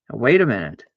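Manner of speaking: ordinary talk, nothing marked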